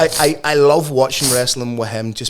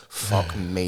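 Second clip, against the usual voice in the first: ordinary talk, nothing marked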